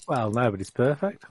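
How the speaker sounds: ordinary talk, nothing marked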